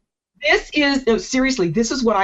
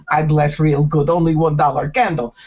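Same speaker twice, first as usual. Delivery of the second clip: lightly put-on Russian fortune teller accent, lower; a hint of a bad Russian accent, pushing candles; mock Russian accent; 4 semitones lower than usual. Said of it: "they do bad Russian accent".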